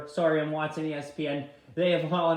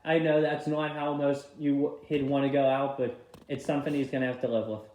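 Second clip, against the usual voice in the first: normal talking voice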